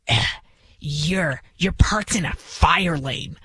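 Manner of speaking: drawn-out, low mock disgust; slow, halting complaint with mock disdain; imitated disgust. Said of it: "looked at my car with such a disgust".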